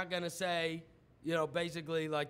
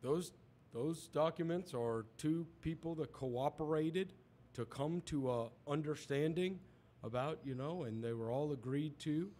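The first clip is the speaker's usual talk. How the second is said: lower, softer hedging reply; mock evasive answer; 5 semitones lower, 6 dB quieter than usual. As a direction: mumble it like a cornered billionaire.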